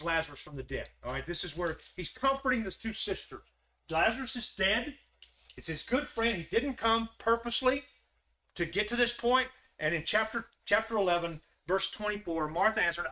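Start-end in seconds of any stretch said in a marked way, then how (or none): 3.9-9.5 lifted preaching voice; higher, stronger sermon telling; heated preaching. Acted. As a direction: push the story up and out. Preacher raises voice, retelling story.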